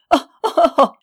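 éclat de rire aigu et fort, en hilarité débordante; rire expansif. Acted. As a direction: se moquer en riant à gorge déployée. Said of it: "l'expansive hilarité du frère". (rire sonore du frère servant)

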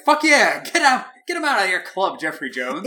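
high, loud cheer of approval; excited cheering. Retold or imitated. Cheering on Jeffrey Jones while watching the movie, loud and high. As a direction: whoop him on like a fan in the stands. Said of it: "And I'm like".

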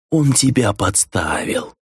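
drawn-out seductive prompting; lightly voiced tempter planting an idea; angel tempter. A narrator's reading. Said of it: "голосом ангела-искусителя продолжил Миклаш".

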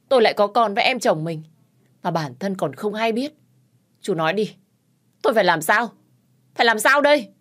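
high, loud, building to a yell; desperate cry. Acted. Wife crying out at her brother-in-law in despair, a building yell. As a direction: plead and rage at once.

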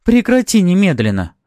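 high, loud, imperious order; exclaimed command. A narrator's reading. Noblewoman orders Maxim to stop, exclaimed command. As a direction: order him to stop at once. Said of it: "воскликнула боярыня".

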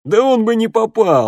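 put-on high, loud boyish jeer; mocking heckle. A narrator's reading. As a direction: cut in to ridicule the story. Boy heckles Gelu's story.

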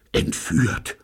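frightened whisper; anxious whispered gasp; fearful whisper. A narrator's reading. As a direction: breathe it out in fear. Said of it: "wisperte Gordon angstvoll".

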